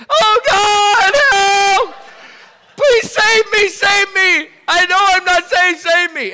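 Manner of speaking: desperate high shouted plea, hammed-up; acted wailing cry for help, very loud and high; crying out to God. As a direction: scream for rescue at the top of your voice. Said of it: "I'm crying out to God".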